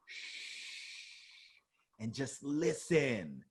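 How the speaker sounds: a soft unvoiced shh, then slow and hushed; hushing. Shown as a demonstration. Hushing the audience to make a point, soft and slow. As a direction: hush the room and slow right down. Telling a listener to hush.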